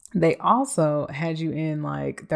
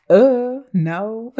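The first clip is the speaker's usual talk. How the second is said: emphatic no; 6 semitones higher, 8 dB louder than usual. Acted. Emphatic refusal.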